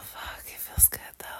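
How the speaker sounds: hushed, aroused whisper; breathless whisper. Real, not acted. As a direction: breathe it out softly. Aroused, touching herself in bed.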